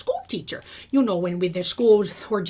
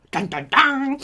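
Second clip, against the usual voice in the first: mock dramatic sting; slower than usual. Mock dramatic sting after pandemic.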